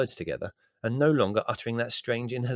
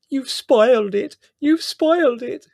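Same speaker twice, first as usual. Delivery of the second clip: tearful wailing; 12 dB louder, slower than usual. Acted. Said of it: "burst into floods of tears".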